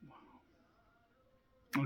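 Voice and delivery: whispered, breathy wow; hushed wonder. Acted. One self talking to itself, in a hushed, awed whisper. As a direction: marvel under your breath.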